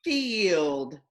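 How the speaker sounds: slow segmented reading; stretched out. Shown as a demonstration. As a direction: stretch "field" sound by sound.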